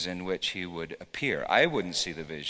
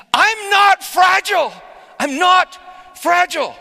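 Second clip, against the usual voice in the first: high, loud, slow triumphant shout; exclaimed; 18 dB louder, slower than usual. Retold or imitated. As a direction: cry it out in sudden freedom. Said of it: "she just exclaimed".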